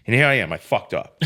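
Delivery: ordinary talk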